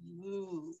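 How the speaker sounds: low, soft imitated moo; cow moo. Shown as a demonstration. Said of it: "and moo as".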